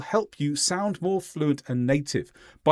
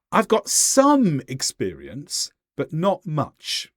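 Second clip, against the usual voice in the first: "some" drawn out and stressed, slower pace; emphasis on some; slower than usual. Shown as a demonstration. Teacher shows learners how to sound more fluent, emphatic "some". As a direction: lean hard on "some", taking your time. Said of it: "What's the difference? It's purely emphasis".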